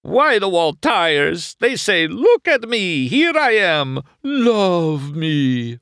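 high, loud Italian rapture; rapturous Italian shopkeeper. Acted. Luigi showing off his whitewall tires, rapturous Italian. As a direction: sell the whitewalls like a love song.

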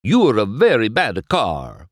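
Luigi scolding. A narrator's reading. Luigi goes on scolding McQueen.

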